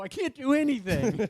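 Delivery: high, slow mock despair; helpless whine. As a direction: draw out a hammed-up helpless wail. Acting out waking up too late.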